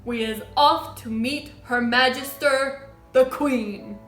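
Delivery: loud, grand proclamation; cried announcement. A narrator's reading. Giant sets off to meet the Queen, cried out. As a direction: announce the journey loudly. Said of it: "cried the B F G".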